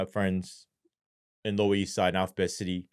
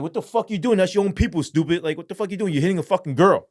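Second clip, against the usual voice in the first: indignant, higher-pitched telling-off; retold angry confrontation; 6 semitones higher, 4 dB louder, faster than usual. Officer confronting a man hitting his girlfriend, angry. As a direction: re-enact outraged disbelief, pitched up.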